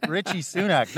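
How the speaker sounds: laughing at the name; amused, laughing aloud. Real, not acted. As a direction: chuckle through the name.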